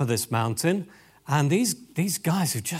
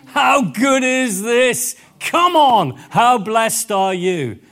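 loud, high, slow and emphatic; rally the followers with joy; rousing exclamation; 4 semitones higher, 7 dB louder, slower than usual. Acted. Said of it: "Jesus is saying".

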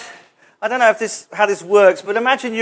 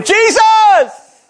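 high dramatic shout to Jesus; hammed-up desperate call; crying out; 13 semitones higher, 4 dB louder than usual. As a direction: cry out as in a last-moment plea. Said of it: "cry out to Jesus".

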